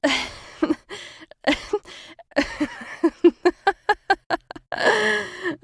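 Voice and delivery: long, high, loud evil laugh; gloating monster laugh. Acted. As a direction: cackle in triumph over prey. Doppelganger revealed, gloating.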